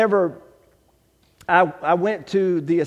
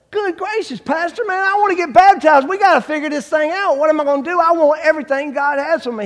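high, loud, eager retold plea; excited pleading; 8 semitones higher, 6 dB louder than usual. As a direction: pour out your eagerness to the pastor, voice pitched up high. Retelling eager plea to pastor.

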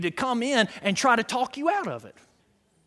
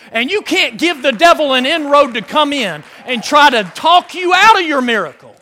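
loud, raised, emphatic preacher delivery; full-force preaching; 4 semitones higher, 7 dB louder than usual. Real, not acted. Preacher urging people to block out unbelief, full-force preaching. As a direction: drive it home to the congregation.

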